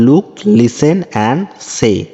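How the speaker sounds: very slow, careful English reading; slow read-aloud. Shown as a demonstration. Teacher reading the textbook instruction slowly to pupils. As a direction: spell it out slowly for the class.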